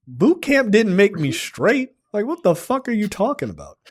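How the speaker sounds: high, swooping mock disbelief; incredulous retort. Marine son answering his mother's assumptions, incredulous.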